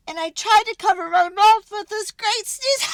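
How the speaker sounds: high and loud, sneeze rising; building to a sneeze. A narrator's reading. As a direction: strain upward as the sneeze comes.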